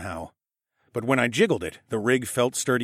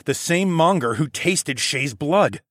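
plain reading voice

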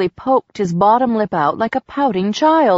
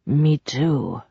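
breathed; 5 semitones lower than usual. A narrator's reading. Strained man breathing to the woman leaning over him. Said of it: "he breathed".

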